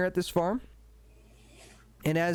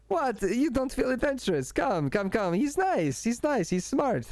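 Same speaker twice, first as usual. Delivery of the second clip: eager coaxing; 7 semitones higher than usual. Acted. Emil coaxes his sister on phone.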